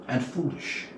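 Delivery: ordinary delivery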